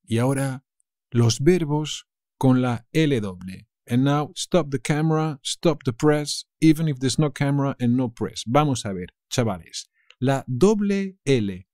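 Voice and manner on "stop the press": usual speaking voice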